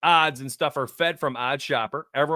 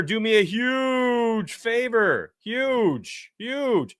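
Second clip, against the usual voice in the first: pleading; 7 semitones higher, much slower than usual. Real, not acted. Pleading with viewers.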